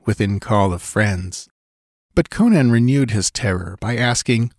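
plain reading voice

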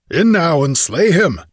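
high yelled command. A narrator's reading. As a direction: yell an order to attack to your men. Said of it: "yelled Ascalante".

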